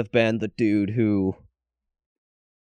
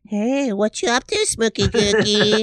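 high, drawn-out cutesy voice; mock baby talk; 8 semitones higher than usual. Host mocking how he'd greet his wife, high baby talk. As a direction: coo it like baby talk to a pet name. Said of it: "closest thing to baby talk I would do".

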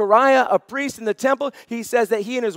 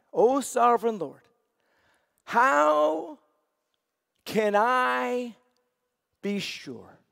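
earnest plea; slower than usual. Acted. Abram pleading with God, read aloud.

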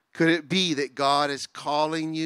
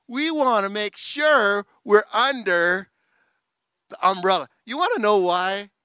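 loud, high, driving sermon voice; preaching at full force; 8 semitones higher, 8 dB louder than usual. Acted. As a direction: preach it out, building fervour.

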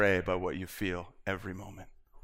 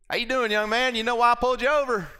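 put-on Southern trooper accent; 12 semitones higher, 5 dB louder than usual. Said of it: "I didn't speak Southern".

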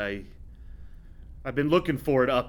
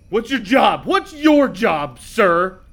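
loud, higher, slowed yell; mock angry shout; 5 semitones higher, 7 dB louder, much slower than usual. Captain yelling at the husband to state his job, loud. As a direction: demand it like a furious boss.

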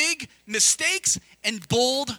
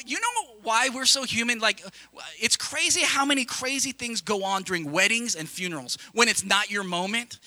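ordinary talk

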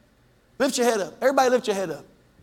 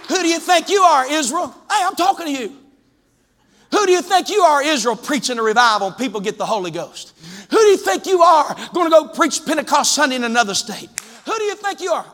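mocking challenge; 6 semitones higher than usual. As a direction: throw the sneer at him like an accuser. Preacher mocks the doubters' challenge.

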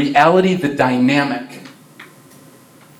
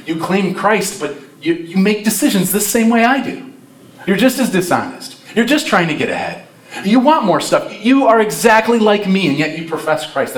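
higher-pitched, incredulous challenge; acted accusation; 7 semitones higher than usual. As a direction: call out a hypocrite, in mock indignation.

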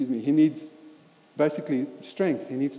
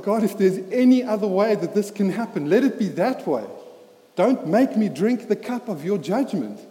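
raised, pleading cry to God; anguished plea; 5 semitones higher, 6 dB louder than usual. Retold or imitated. Jesus pleading with God in the garden, anguished and loud. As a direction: beg to be spared, voice strained and loud. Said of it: "his body is like".

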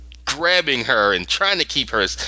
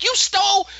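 high-pitched mock outburst; retold accusation, pitched way up; aggressive accusation. Lyra confronting the man who took it, aggressively. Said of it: "being just really aggressive".

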